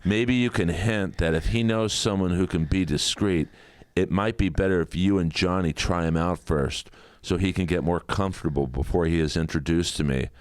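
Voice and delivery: ordinary talk, nothing marked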